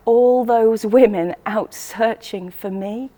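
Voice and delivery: ordinary delivery, nothing marked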